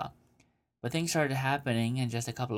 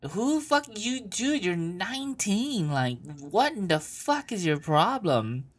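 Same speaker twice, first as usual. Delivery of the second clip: incredulous rant; 9 semitones higher than usual. Real, not acted. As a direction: go off on her. Ranting at letter writer.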